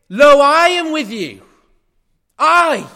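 high, loud ringing proclamation; proclaimed shout. Acted. Preacher quoting Jesus to the church, a high ringing shout. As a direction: cry it out like a herald.

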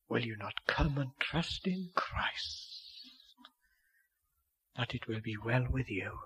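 slow whispered appeal. Acted. Preacher imploring hearers to trust Christ, slow whisper. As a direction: whisper it slowly, pleading.

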